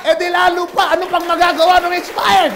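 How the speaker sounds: high, loud triumphant retort; the mother shouts her winning point to her son. Acted.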